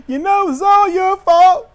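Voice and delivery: high, loud, whiny mock voice; whiny Kevin. Mocking Kevin blaming Cody, high whiny whimper. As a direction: whine it like a sulking child.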